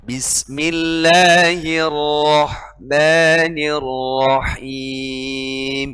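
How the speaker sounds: Bismillahirrahmanirrahim chanted slowly with a heavy r in rahman; slow recitation, thick r. Shown as a demonstration. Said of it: "seperti yang tadi".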